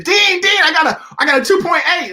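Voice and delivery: high, loud, thrilled calling; student bursting into the office with good grades; excited shout. Retold or imitated. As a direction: burst in calling the dean, beaming.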